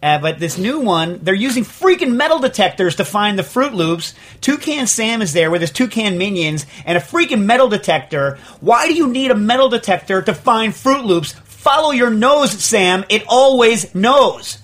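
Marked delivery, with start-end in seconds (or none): 1.2-11.3 heated, higher-pitched indignation; ticked-off rant. Real, not acted. Host rants about a cereal advertisement, ticked off. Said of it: "what really ticks me off". 11.6-14.6 loud, high, indignant call with a stretched ending; exasperated slogan. Real, not acted. Host scolds the cereal mascot with his own slogan, exasperated. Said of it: "what really ticks me off".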